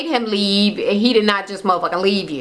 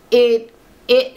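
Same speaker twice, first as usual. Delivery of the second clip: usual speaking voice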